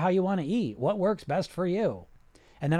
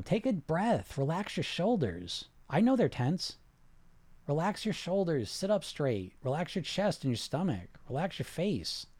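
softer, calm breathing cues; guided relaxation; 5 dB quieter than usual. Acted. Coach guiding viewers to relax their bodies, soothing. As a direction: talk them down gently, unhurried.

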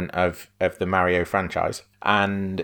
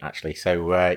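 usual speaking voice

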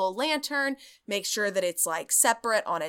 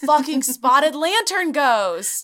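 higher, louder and slow, hammed-up annoyance; mock annoyed curse; 5 semitones higher, 7 dB louder, slower than usual. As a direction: spit it out like a cursed pest. Cursing the pesky ghost.

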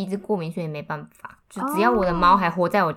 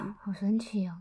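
whispered; 11 dB quieter than usual. Real, not acted. Whispered reply about quiet.

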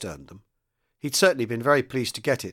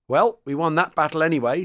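louder, higher wry remark; 5 semitones higher, 5 dB louder than usual. A narrator's reading. Sergeant returning to his crew, wry. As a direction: announce it with a touch of irony. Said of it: "said Donovan wryly".

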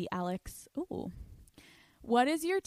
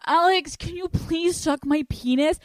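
mock whiny guy; 8 semitones higher, 5 dB louder than usual. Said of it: "he's like".

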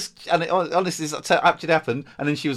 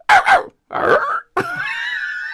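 very high imitation barks, louder; questioning dog bark; 9 dB louder than usual. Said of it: "bark like you're asking a question".

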